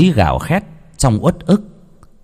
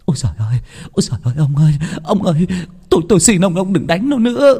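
high, desperate wailing cry; a mother begging through her sobs; rising frantic lament, much higher; wailing plea; 9 semitones higher, faster than usual. A narrator's reading. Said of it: "Bà Lý kêu gào thảm thiết".

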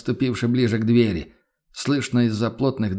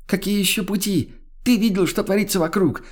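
furious yell; 8 semitones higher than usual. Acted. Woman yelling through door.

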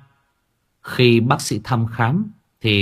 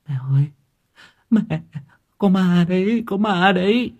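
much higher, frightened wailing; crying in terror; 10 semitones higher than usual. Acted. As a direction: wail that there is a ghost. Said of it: "Thảo càng khóc lớn".

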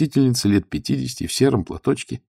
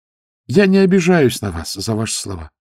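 ordinary delivery

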